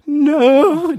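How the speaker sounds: high, loud wailed denial; mock crying. Schoolboy denies crying to the bully, mock tearful wail. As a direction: wail it comically through pretend tears. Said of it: "I started crying in relief".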